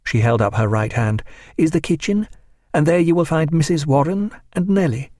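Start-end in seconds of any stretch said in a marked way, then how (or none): 1.6-5.1 higher-pitched, polite woman's instructions; Clarissa giving directions. A narrator's reading. Hostess sending servant down the corridor, higher polite voice. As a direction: direct him courteously in a raised voice.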